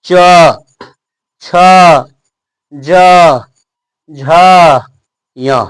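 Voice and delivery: slow, spaced letters with the tongue on the palate; teacher sounding out the palatal letters; palatal letter demo. Shown as a demonstration. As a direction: say "च, छ, ज, झ, य" one at a time with long pauses. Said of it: "हम जैसे बोल रहे हैं".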